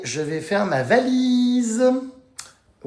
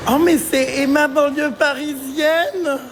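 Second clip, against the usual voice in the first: high, slow drawn-out teasing greeting; mock surprised greeting; 6 semitones higher than usual.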